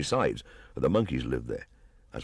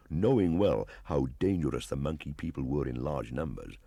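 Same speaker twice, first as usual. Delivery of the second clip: plain reading voice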